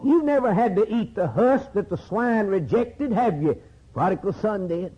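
high, insistent pulpit cry; impassioned preaching. Real, not acted. Preacher contrasts congregation with prodigal son, high impassioned preaching. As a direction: challenge the listeners, pitched high and easing at each tag question.

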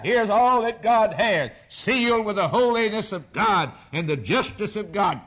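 building proclamation. Acted. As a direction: preach God's words with mounting force. Preacher voices God presenting the book.

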